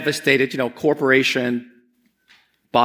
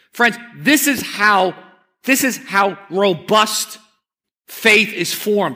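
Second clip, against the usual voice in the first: slow emphatic; 5 semitones higher, slower than usual. Real, not acted. Preacher's key point.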